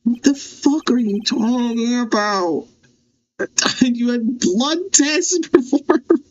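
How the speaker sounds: high, slow, laughing through words; cracking up in shock; laughing disbelief. Real, not acted. Player laughing at the witness's blood tests, laughing disbelief.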